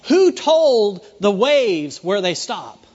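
loud, slow ringing question; preaching call. Real, not acted. Preacher challenging the congregation, loud and slow. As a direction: proclaim it to the whole room.

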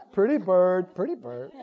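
low squawky parrot voice. Pretend. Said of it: "You're just like a parrot".

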